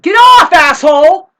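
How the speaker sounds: top-of-the-lungs yell repeated at the man; furious shout. Retold or imitated. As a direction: shriek it at him. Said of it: "I told him that".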